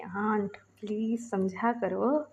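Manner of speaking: soft, slow pleading whisper; a hushed plea to the aunt. Retold or imitated. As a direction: beg her quietly under the breath.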